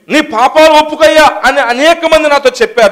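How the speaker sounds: loud, high-pitched sermon delivery; full-force preaching. Real, not acted. Interpreter voices a preacher's testimony, preaching at full force. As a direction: belt out the confession to the congregation.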